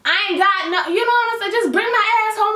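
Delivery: high, pleading voice, heart racing. Retold or imitated. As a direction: beg him for a ride home. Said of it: "because I'm like".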